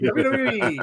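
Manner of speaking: mock the guy who can't say his W's in "W W E"; mocking his stupid voice. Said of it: "he doesn't say his W's right".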